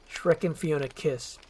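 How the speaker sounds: ordinary delivery, nothing marked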